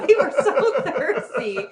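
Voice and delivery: half-laughing at a silly memory. Real, not acted. Recalling the thirsty trip to family, laughing.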